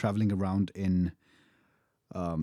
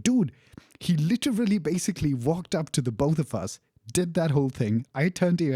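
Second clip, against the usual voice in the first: can't believe his friend missed it; incredulous retelling; 6 semitones higher than usual. Retold or imitated. Reminding his spacey friend about the Nazi guy, incredulous.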